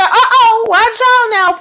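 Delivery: high, laughing uh-oh, watch out now; gleeful uh-oh. Real, not acted. Host gleefully warning Parker at the tense table, laughing and high. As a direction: tease her with a squeal of delight. Narrator reacting to the clash.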